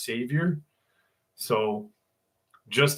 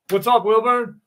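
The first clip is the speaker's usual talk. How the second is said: high, loud hello; shouted greeting; 9 semitones higher, 6 dB louder than usual. Real, not acted. Streamer saying hello to a viewer, shouting. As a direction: call it out loudly to a pal.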